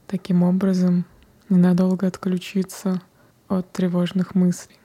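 usual speaking voice

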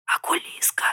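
испуганный шёпот; прошепчи, чтобы зверь не услышал. A narrator's reading. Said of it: "прошептал лис". (тихим шёпотом)

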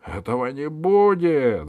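high, loud angry refusal; shouted refusal. A narrator's reading. Valet refuses Pashka's request, shouting high and loud. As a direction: shout it down firmly. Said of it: "закричал камердер".

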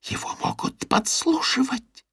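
slow, dramatic whisper; tragic whisper. A narrator's reading. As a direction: whisper it slowly like a conspiracy. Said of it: "трагическим шёпотом".

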